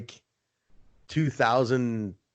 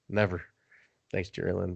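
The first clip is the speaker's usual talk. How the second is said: normal talking voice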